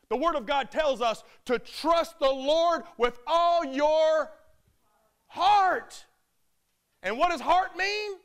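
builds to a loud, high shout; the pastor pounding home the verse; preaching at full force. Real, not acted. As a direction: preach it, climbing to a cry.

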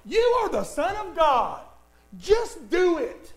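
high taunting push; pushy tempter. Retold or imitated. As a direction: egg him on like a devil. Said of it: "He said, he said".

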